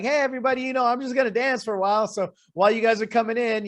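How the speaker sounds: high, loud, quick imitation of a livestream host; mock upbeat streamer greeting. Imitating an influencer opening her dance livestream, high and upbeat. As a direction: greet the arriving viewers like a peppy influencer.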